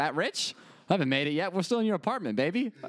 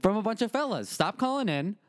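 radio DJ voice; 5 semitones higher than usual. Pretend. Radio DJ bit.

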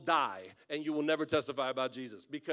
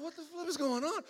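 very high baffled squeak; mock bewilderment; 12 semitones higher than usual. Pastor reacting to two strangers crying, mock bewilderment. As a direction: squeal it in hammed-up disbelief.

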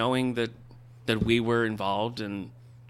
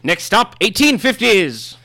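high, loud, pompous gentleman voice; grand self-important literary heir; Christopher Tolkien voice; 10 semitones higher, 10 dB louder, slower than usual. Acted. Holds the Tolkien character voice.

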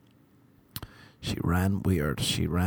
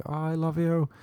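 put-on girl doting on her dog; 6 semitones higher than usual. Girl at the shop to her dog, mock doting. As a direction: coo it to a pet, lightly put on. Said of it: "to her dog, she was like".

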